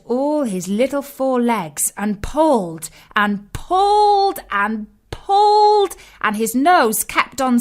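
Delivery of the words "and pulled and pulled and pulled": higher, louder and slow, heaving with each pull; straining effort. A narrator's reading. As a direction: build the strain on each pulled. Straining tug of war.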